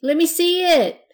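put-on high, loud begging child; pleading. A narrator's reading.

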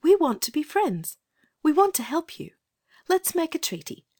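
put-on very high-pitched, coaxing little girl; kind little voice. Girl offering the captive priest a treaty, kind little voice. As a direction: offer friendship kindly, in a small high voice. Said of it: "in her kind little voice".

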